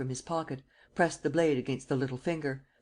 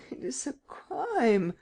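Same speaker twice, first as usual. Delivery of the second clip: higher, slow moaning plea; 10 semitones higher, slower than usual. Acted. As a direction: moan it out, high and drawn. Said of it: "moaned Baupré".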